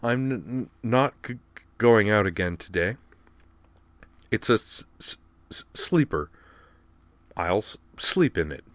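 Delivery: halting stutter on opening consonants; stammering. A narrator's reading. Girl says she is staying in today, stammering. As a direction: catch and repeat first sounds, then push the word out.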